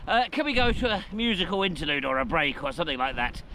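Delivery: breathless after the summit climb; panting a little between words; out of breath. Real, not acted. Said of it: "I just need to catch my breath".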